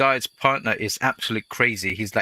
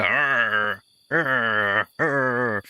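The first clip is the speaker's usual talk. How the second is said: jealous growling; much slower than usual. Retold or imitated. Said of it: "he's gonna look and go".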